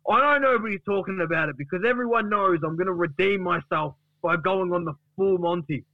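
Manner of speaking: high, grandly put-on announcement; defiant Duck. Duck promises Gary he will redeem himself, defiant boast. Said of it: "And Duck says".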